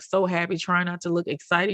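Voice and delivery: ordinary delivery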